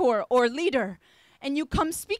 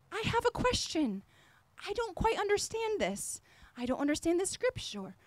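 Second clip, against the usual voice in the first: soft, lowly asking voice; humble quiet question; 4 semitones higher, 8 dB quieter than usual. Shown as a demonstration. Preacher models how to ask his mentor, softly humble. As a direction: ask it gently, like a humble student. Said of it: "And so come, like".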